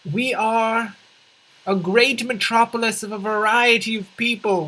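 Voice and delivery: high, declaimed civic speech; grand mayoral proclamation. Pretend. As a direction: proclaim it proudly to the whole city.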